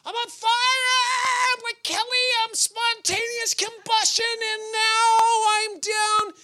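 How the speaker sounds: his former self boasting then complaining to God, hammed up; mock fired-up self. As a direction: shout on fire then slump into a plea. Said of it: "I used to watch my life".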